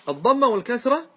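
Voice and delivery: teacher stresses الضمة والكسرة to the class. Shown as a demonstration. Teacher points students to الضمة والكسرة, stressing both. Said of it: "شوف".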